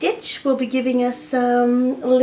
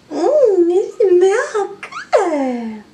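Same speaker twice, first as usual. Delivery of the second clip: gushing; 8 semitones higher, 5 dB louder, much slower than usual. Real, not acted. Cooing over perfume-scented pets.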